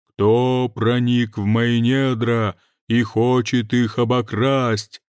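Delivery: slow, loud booming mountain spirit; thunderous voice. Acted. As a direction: boom it out like a mountain god. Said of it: "раздался громовой голос".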